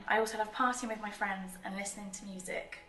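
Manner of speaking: ordinary delivery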